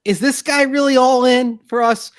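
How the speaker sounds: 3 squeak it out like a worried local fan; 1 doubting Bills fan. Retold or imitated. 4 host mimicking a Buffalo fan's doubt, high and put on. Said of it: "you're like".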